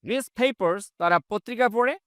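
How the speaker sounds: ordinary delivery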